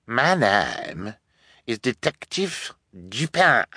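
affected, deliberate self-introduction; put-on detective voice with odd vowels; pompous fake detective. A narrator's reading. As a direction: savour the false name. Villain insists on false identity.

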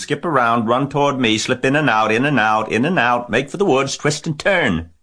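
high, loud string of commands; goose giving orders. A narrator's reading. Goose directs fleeing Wilbur, crying out orders. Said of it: "began to give orders".

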